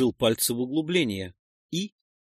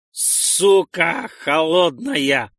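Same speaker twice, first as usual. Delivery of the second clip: slow, louder yelp at the cold water; howling curse; 4 semitones higher, 6 dB louder, much slower than usual. Acted. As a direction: wail the curse out. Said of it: "взвыл он".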